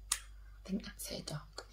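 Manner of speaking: breathy whispered aside to listeners; whispered joke aside. Real, not acted. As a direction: drop to a secret whisper. Aside about the dog.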